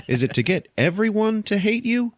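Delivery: very high, incredulous question; acted exasperation. Quill confronting rude Rocket, high-pitched exasperated mock question.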